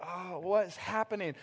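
lightly voiced groggy child; a hint of dazed, just-woken kid; bewildered kids. Voicing kids woken abruptly.

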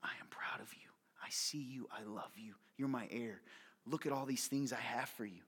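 soft hushed whisper of a loving father; God whispering love. Pretend. Preacher voicing God to listeners, hushed whisper. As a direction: breathe it gently as God speaking to his child. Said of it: "he's looking at you right now and saying".

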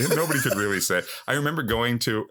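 usual speaking voice

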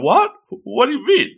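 hammed-up puzzled squeak; baffled. Acted buddy left baffled.

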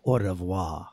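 low, quiet movie tough-guy line; Van Damme one-liner. Retold or imitated. Hero after killing the main bad guy, low, quiet one-liner. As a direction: drop it low and soft, deadpan kiss-off. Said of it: "he's like".

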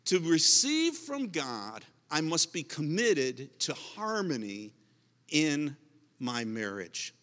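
slow, deliberate statement of the main point; slow emphatic point. Real, not acted. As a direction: read the point out slowly, weighing each word. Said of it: "Okay, number four".

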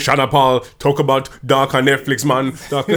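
hammy Jamaican accent impression of a singer. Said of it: "that was a terrible Jamaican accent".

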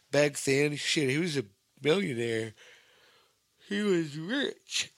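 talking through a yawn; words stretched by a yawn. Real, not acted. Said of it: "sorry if I'm yawning".

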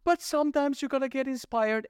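high sing-song mimicry; squeaky parody of an inspired shopper; mocking voice. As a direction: make them sound silly and naive. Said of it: "they're like".

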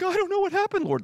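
high-pitched mock bewilderment; bewildered plea. Hapless believer excusing himself to the Lord, high-pitched bewilderment. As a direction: whine it up high like a clueless excuse.

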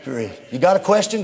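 normal talking voice

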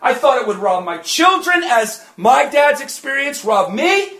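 loud, rising preacher's climax, often shouted; preaching at full force. Acted. Preacher testifying to congregation about deliverance, loud and fervent. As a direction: drive the testimony home at full volume.

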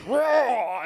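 loud, high creature roar; golem roar. Acted. Game master as the golem hit by fire, roaring. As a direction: roar out the word like a monster. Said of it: "The golem goes".

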